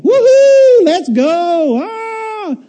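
very high, loud whoops; thrilled rider cheer. Retold or imitated. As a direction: cheer like hands up on the drop. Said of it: "free for the ride".

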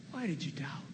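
whispered softly, with no voice; a tender whisper from Jesus to Peter; gentle whisper. Acted. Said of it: "what Jesus is saying".

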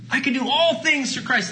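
high, leaning hard on "all"; stress on all. Shown as a demonstration. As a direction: lift "all" high and hold it. Repeats the verse, higher.

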